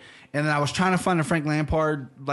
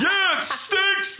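much higher, very slow, laughing Rocky imitation; Rocky impression; much slower than usual. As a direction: lose it into laughter mid-Rocky. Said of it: "and he goes".

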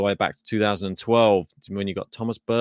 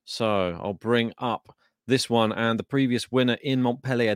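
normal talking voice

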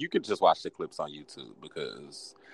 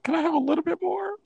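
much higher whiny plea for more; pleading. Pretend. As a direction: beg like a kid asking for candy. Begging for more clues.

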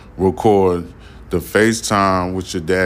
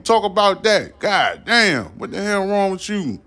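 high, fast, indignant tirade; heated rant; 8 semitones higher than usual. Real, not acted. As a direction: tear into the gossiping influencer. Ranting at an unnamed influencer.

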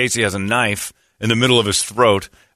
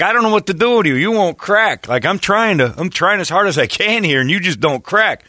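high-pitched acted frustration; mock exasperation; 8 semitones higher, 3 dB louder than usual. Killer tells victim he won't crack, mock exasperated. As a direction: throw up your hands, pitched high.

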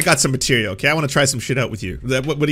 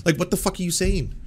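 usual speaking voice

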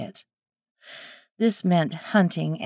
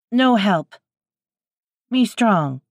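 higher and louder, halting threat; broken-English wild woman; 3 semitones higher, 5 dB louder than usual. A narrator's reading. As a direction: chop the words like a hostile stranger. Wild woman refuses, tensing.

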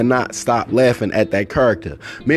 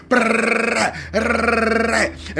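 high rattling brrrr of a machine gun; imitated tommy-gun burst; 8 semitones higher than usual. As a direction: roll it like rapid gunfire. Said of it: "it was like".

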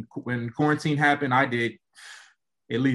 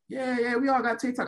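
much higher teasing teammate voice; 12 semitones higher than usual. Retold or imitated. Teammates telling him they all saw it, high teasing. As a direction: toss it off like a smug teammate. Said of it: "And they're like".

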